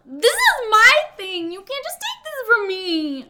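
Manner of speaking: high, loud mock outrage; mock outraged. Retelling Reed's protest to a friend, high mock outrage. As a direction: whine it like a wounded child. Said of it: "Reed just says".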